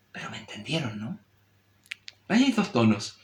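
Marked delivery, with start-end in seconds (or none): 0.1-1.2 softer, lower, confiding aside; complicit, companionable. Shown as a demonstration. Teacher checking listeners understood, quiet colloquial complicity. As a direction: drop down to a close, chummy check-in. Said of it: "el segundo parece que era de complicidad".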